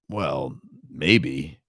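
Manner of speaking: low, soft smug grunt. Acted. Shad answering Lorinda, low and smug. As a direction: sound self-satisfied and lazy. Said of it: "with the smugness of a carp".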